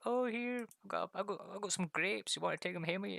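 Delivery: put-on high, fussing old lady offering grapes; Scottish old lady offering grapes, high and doting; sweet old wifey. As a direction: do a kindly granny pressing food on you. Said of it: "one of the old wifeys that are like".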